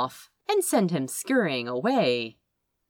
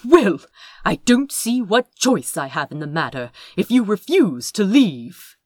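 lightly voiced, louder stubborn hero; 5 dB louder than usual. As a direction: stand firm, a bit pompous.

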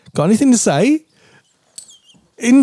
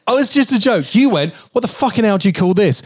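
high, mocking wife voice; 9 semitones higher than usual. Husband mimicking the wife's version of events. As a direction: ham up her angry question.

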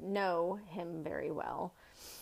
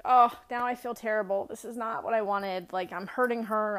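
louder, higher rush of guilty panic; alarmed inner thought; 3 semitones higher, 6 dB louder than usual. Retold or imitated. She reacts on the phone, narrator retells her own guilty panic, louder. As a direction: replay the sinking moment of hurting her.

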